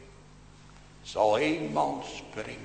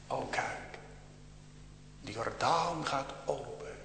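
hushed, breathless last words; dying whisper; 11 dB quieter than usual. Retold or imitated. Preacher voicing a dying man's last whisper. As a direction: whisper it as if seeing heaven.

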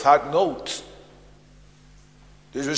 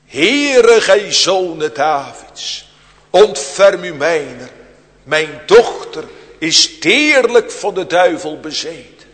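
a loud, high cry of "Heere" then a pleading appeal; loud pleading cry for help; 3 semitones higher, 8 dB louder than usual. Retold or imitated. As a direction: call out to the Lord as a desperate mother. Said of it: "Riep tot hem. Zeggende".

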